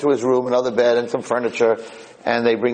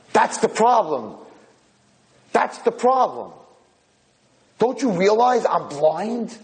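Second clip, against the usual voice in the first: slow, high-pitched lament; anguished; 10 semitones higher, slower than usual. Acted. Old man reveals to roommate he is blind, anguished. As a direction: draw it out high, in despair.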